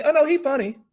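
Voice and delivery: a put-on dismissive diva; hammed-up diva brushing it off. [name] voiced in retold story.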